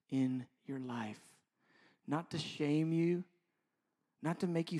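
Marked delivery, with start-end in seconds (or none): none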